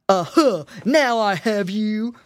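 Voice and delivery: loud, gloating storybook wolf; crying wolf. A narrator's reading. Wolf seizes the pig lady, crying out in triumph. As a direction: cry it out loud and drawn out as the wolf. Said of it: "cried the wolf".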